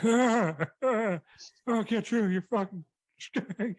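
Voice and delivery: high, mock-agonised threat; hammed-up wounded cry, slowing at the end; injured wrestler. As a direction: groan it out as if badly hurt. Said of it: "But he's that injured".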